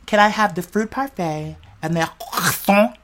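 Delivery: slow, soft order with a throaty French croissant; acting out an order. Imagining ordering breakfast in their mind, slow affected order. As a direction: order softly and slowly, overdoing the croissant. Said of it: "I'm playing this out in my mind".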